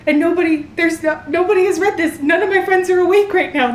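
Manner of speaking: high, loud, worked-up outburst; frantic and high-pitched, needing someone to tell; agitated distress. Real, not acted. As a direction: blurt it out loudly in a panic. Distressed, nobody to share shock with.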